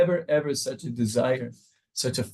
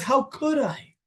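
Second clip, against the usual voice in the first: much higher, anguished question; pained outrage at oneself; outraged; 11 semitones higher, slower than usual. Retold or imitated. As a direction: cry out how could you have done this. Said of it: "He wants us to have the outrage".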